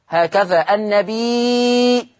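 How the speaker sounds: النبي with the ياء stretched very long and slow; long drawn vowel. Shown as a demonstration. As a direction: draw out the ي of النبي in full. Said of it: "هذه ياء مشبعة مد متصل". (مد مشبع في النبي ببطء)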